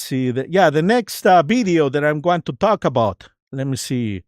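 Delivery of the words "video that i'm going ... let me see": ordinary delivery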